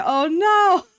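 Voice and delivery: loud high cry of dismay. Real, not acted. Dismayed reaction to working late.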